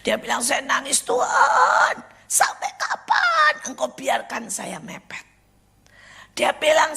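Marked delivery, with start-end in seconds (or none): 0.4-5.3 imitated crying prayer. Friend crying out to God, imitated wailing lament. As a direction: act out a drawn-out, tearful plea. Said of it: "saya baru nangis sama Tuhan".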